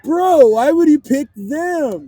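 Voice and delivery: high, loud, drawn-out protest; playfully outraged whine; mock indignant. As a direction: protest in hammed-up disbelief, stretching the words. Acted protest at friend's pick.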